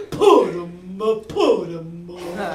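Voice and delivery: mock-tough lion, slow and loud; Cowardly Lion. Shown as a demonstration. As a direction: growl it with paws up. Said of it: "big paws out in front of you".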